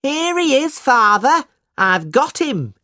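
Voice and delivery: put-on a triumphant boy yelling to his father; high, gleeful child's call. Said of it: "the high voice of a boy".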